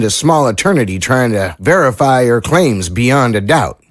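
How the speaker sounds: country lawman weighing the odds; folksy small-town sheriff, doubtful. Acted. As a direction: play a sheriff giving an honest, wary opinion.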